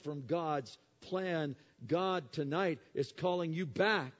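loud, high, impassioned preacher's call; rising revival cry, full voice; preaching at full force. Acted. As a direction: plead with the whole room to come back.

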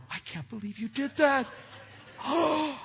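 high, gasping mock shock; shocked disbelief. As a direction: act appalled at a child's misdeed. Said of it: "He's never going".

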